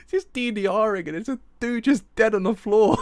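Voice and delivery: loud, high, near breaking into laughter; high-pitched laughing disbelief; hysterical. Real, not acted. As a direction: blurt it out while cracking up. Describing scene amid laughing fit.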